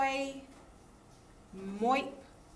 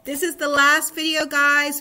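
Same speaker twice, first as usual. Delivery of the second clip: excited; 4 semitones higher, 10 dB louder than usual. Real, not acted. Teacher's excited announcement.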